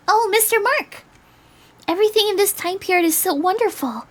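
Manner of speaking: put-on high, excited girlish voice; high-pitched, starry-eyed character; gushing girl. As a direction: gush with delight in a high voice. Voicing Julie gushing in story.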